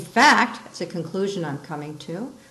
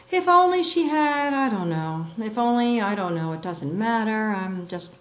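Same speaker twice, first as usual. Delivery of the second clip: halting, broken-off phrases; start, stop and let it hang; trailing off; 3 semitones higher than usual. Shown as a demonstration. Teacher demonstrating an ellipsis, trailing off hesitantly. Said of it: "we can say it's trailing off".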